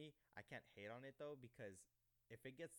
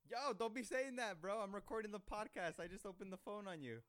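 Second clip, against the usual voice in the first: loud, high-pitched shout to the caller; urgent warning; 10 semitones higher, 10 dB louder than usual. Real, not acted. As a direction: cut the caller off at full volume. Warns caller he's live on podcast.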